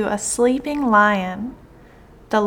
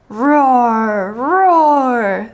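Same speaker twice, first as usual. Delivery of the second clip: drawn-out, louder spoken roar; loud lion roar; 5 dB louder than usual. A narrator's reading. Trapped lion calls out from the net, loud roaring. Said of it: "the lion made loud sounds".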